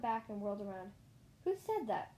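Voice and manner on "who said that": high, startled squeak; startled high-pitched question. A narrator's reading. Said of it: "I squeaked".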